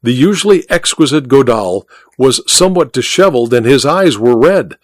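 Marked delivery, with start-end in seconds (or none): none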